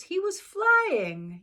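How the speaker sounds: narrating Dumbo's first flight with awe; wonder. A narrator's reading. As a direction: stretch it out in amazement. Joyful climax of the story.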